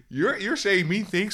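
mock protest. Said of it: "I'm like".

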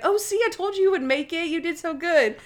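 a lightly voiced chirpy Natalie, high and quick; praising a child. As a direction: cheer him on like a proud helper. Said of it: "Natalie's like".

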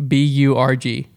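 slow letter by letter spelling; each letter spaced and deliberate. Acted.

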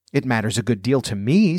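usual speaking voice

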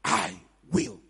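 very low, hushed assurance; solemn low promise. Acted. Preacher voicing a promise of help, solemn and low. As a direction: drop the voice deep and promise it gravely.

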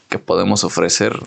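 ordinary talk

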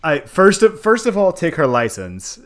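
loud, high outraged start. Real, not acted. Mock outraged rant.

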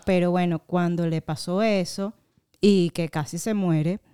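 usual speaking voice

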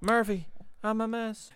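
high-pitched put-on voice; hammed-up high imitation; put-on film quote.

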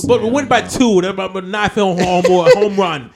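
high, loud mock Boston fan; excited sports fan. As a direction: crow about the winning home run. Joins in the Boston fan bit.

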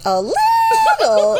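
loud, drawn-out squeal imitating a startled husband; crazy high. Retelling the husband's reaction to the poop prank, crazy high. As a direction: mimic his voice leaping sky-high. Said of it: "like, crazy high".

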